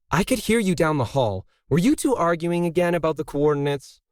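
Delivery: a gentle scold from the doorway; chiding. A narrator's reading. Emily, bursting in on the arguing pair, chiding them lightly. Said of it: "Emily chided".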